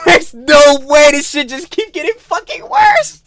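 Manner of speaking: loud, high, drawn-out exclamation; outraged disbelief. Real, not acted. Reactor hearing the story get worse, outraged disbelief. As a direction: cry out in slow, loud disbelief.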